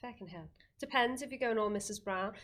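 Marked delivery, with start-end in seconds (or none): 0.1-0.5 soft mock Irish accent. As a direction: say it quietly in put-on Irish. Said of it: "it'd be feckin', wouldn't it".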